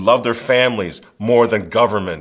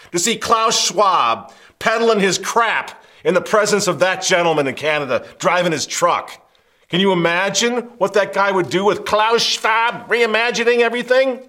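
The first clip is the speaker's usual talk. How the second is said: loud, raised-pitch derisive tirade; scornful rant; 6 semitones higher, 5 dB louder than usual. Real, not acted. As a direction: taunt him loudly, pitch pushed high. Mocking rant at Klaus Schwab.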